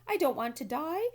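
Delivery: frightened piglet screaming; panicked scream. A narrator's reading. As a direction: shriek it in panic. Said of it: "screamed Wilbur".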